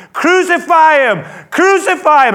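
loud, high mob shout; crowd yelling. Retold or imitated. The crowd screams at Pilate, furious shout. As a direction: yell it like an angry mob. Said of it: "everybody starts yelling".

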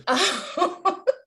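high, loud burst of laughter. Real, not acted. Host cracking up after co-host's gotcha, loud high laugh.

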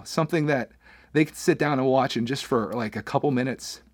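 ordinary delivery

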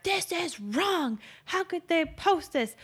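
high, drawn-out mock outrage; outraged commenter. Host mimicking people in the comments, mock outrage. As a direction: put on a slow, high-pitched complainer voice.

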